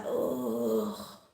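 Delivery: soft, breath-out "Oh"; sinking realisation. Retold or imitated. Woman realising she gave her name as bull, quietly mortified. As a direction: let it out quietly as it dawns.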